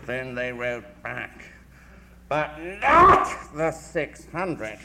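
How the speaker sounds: slow, building to a loud, grand "six hundred"; big emphasis. Retold or imitated. Critic quoting Tennyson's famous last line, slow emphatic build to loud. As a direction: lean hard on "but not the six hundred", slow and swelling. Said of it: "does come over terrifically with a big emphasis".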